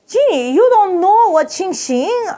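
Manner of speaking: high, slow mock accent; Chinese gentleman. Listener astonished at the storyteller's ignorance, high mock Chinese accent. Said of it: "a Chinese gentleman, who goes".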